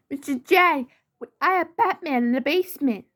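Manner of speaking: high, quick, excited henchwoman; high-pitched sidekick with big news; Harley Quinn. Acted.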